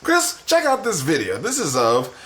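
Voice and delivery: high, loud cartoon voice; squeaky cartoon dad; cartoon character voice. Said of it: "today he talks like a cartoon character".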